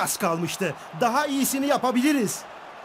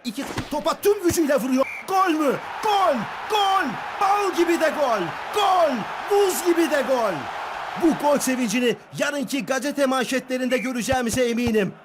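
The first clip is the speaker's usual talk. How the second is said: screaming football commentator; 3 semitones higher than usual. Acted. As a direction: scream the goal out. Goal call.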